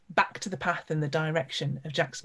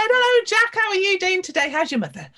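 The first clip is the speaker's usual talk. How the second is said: greeting pitched much higher and louder; cheery shopkeeper greeting; 13 semitones higher, 8 dB louder than usual. Acted. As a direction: sing out a fond hello to the boy, voice way up. Shopkeeper greets a favourite boy.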